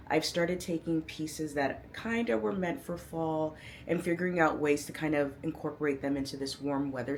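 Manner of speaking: ordinary talk, nothing marked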